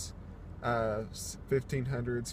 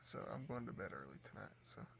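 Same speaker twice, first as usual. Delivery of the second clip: soft, tired late-night voice; drowsy, about to fall asleep; sleepy; 14 dB quieter than usual. Real, not acted. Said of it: "I'm about to fall asleep".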